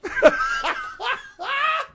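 very high cackling laughter; mocking laugh. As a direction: burst into high-pitched laughter. Laughs at own mocking summary.

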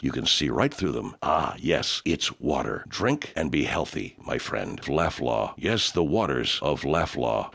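low, slow, reverent ad voice; deep hushed pitchman voice; deep mystical announcer. Acted. Deep ad voice for water.

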